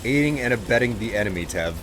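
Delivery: ordinary talk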